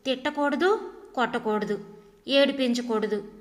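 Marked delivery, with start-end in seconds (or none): none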